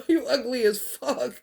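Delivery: amused retold jab through laughter; laughing insult. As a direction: crack up while teasing.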